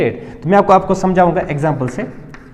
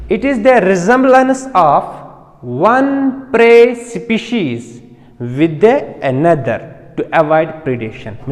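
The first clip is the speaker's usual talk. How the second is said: slow dictation; 2 semitones lower, much slower than usual. Shown as a demonstration. Teacher dictating to students, slow and measured. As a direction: dictate slowly, word by word. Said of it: "dictating a definition".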